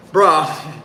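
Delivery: giggled bruh; laughing at yourself; giggling. Real, not acted. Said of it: "there I go with the giggles".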